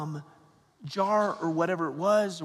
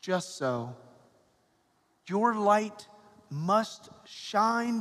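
Gospel reading. Acted. Proclaims the Gospel at Mass.

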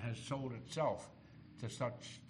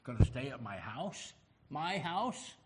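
rising, incredulous questions, building higher and louder; can't believe the honour, voice climbing; astonished disbelief; 3 semitones higher, 6 dB louder than usual. Acted. Zacchaeus learns Jesus will stay with him, astonished.

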